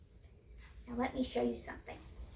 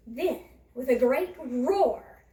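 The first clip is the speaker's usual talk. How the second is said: slow and louder, dramatic build; dramatic roar; 8 dB louder, slower than usual. A narrator's reading. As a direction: draw it out for the big moment. Climax of the turnip emerging.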